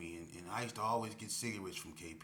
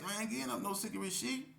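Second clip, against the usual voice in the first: voicing K P refusing; 12 semitones higher than usual. K P voiced refusing.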